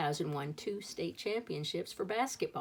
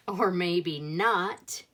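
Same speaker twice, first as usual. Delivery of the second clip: slow, louder, drawn-out "Or maybe not."; dry punchline; 7 dB louder, much slower than usual. A narrator's reading. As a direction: land the joke with a deliberate pause. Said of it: "maybe someday".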